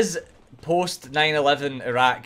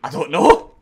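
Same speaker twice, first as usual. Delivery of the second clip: being chased; 7 semitones higher, 12 dB louder than usual. Real, not acted. Frantic fight in the game.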